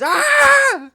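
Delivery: high loud shriek of frustration; frustrated shriek. Real, not acted. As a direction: scream it as the maze beats you. Frustrated shout at maze.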